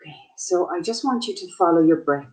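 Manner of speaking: unhurried meditation guide, long pauses between phrases; short, spaced-out calming instructions; guided meditation. Acted. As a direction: guide listeners gently into their breath.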